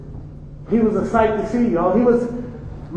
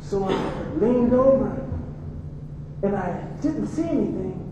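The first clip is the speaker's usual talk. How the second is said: hushed painful telling; 2 semitones lower, 9 dB quieter than usual. Acted. Mother recounts assault, fading.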